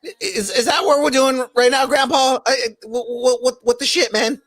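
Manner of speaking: put-on high, stammering panicky teen; high anxious cartoon kid voice; Morty voice. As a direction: stutter it out like nervous Morty. Morty voice addressing Grandpa.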